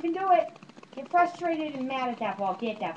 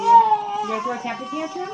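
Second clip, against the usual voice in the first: louder, higher mock-alarmed question; teasing; 4 semitones higher, 6 dB louder than usual. Real, not acted. Teasing baby about tantrum.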